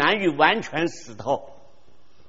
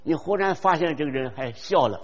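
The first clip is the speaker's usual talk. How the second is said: ordinary talk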